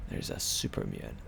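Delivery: hushed stealth whisper. Real, not acted. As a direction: breathe it so the enemy won't hear. Spotting an enemy, sneaking.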